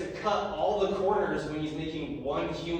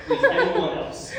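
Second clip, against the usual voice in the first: high, louder mock begging with a laugh; hammed-up desperate plea; 4 dB louder than usual. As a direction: beg for anyone else, breaking into laughter. Adam begging Jesus to leave.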